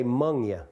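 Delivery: "'mong ya" run together as mung-ya; local pronunciation. Shown as a demonstration. Speaker teaching the local word for y'all, dialect "'mong ya". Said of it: "people don't say y'all, they say".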